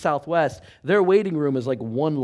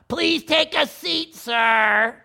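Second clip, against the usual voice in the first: high, dismissive front-desk voice; receptionist; 13 semitones higher, 8 dB louder, much slower than usual. Retold or imitated. Desk lady to pastor, brushing him off high. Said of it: "receptionist again".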